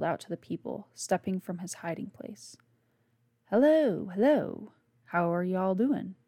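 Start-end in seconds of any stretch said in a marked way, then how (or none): 3.4-6.2 slow, forced Southern drawl. Acted. Stranger greeting the hippies by the fire, put-on Southern drawl. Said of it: "tried to put the best drawl into his voice".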